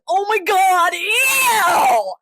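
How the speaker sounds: high, loud, drawn-out whine; mock disgust. Critics seeing someone diverse, hammed-up disgusted shriek. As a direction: wail in exaggerated revulsion.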